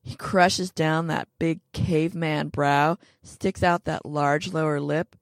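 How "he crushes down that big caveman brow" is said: ordinary delivery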